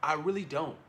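ordinary talk, nothing marked

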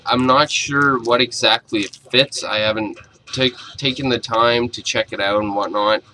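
ordinary talk

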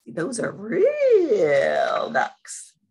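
usual speaking voice

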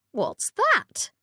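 high, startled question; curious queen voice; surprised queen. A narrator's reading. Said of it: "said the Queen".

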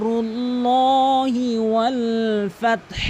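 slow chanted রুল্লাহি with long "lo", breathy h ending ফাতহ; full lo. Shown as a demonstration. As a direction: intone রুল্লাহি slowly, rounded and stretched. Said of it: "পুর করে বলতে ল বলতে হবে".

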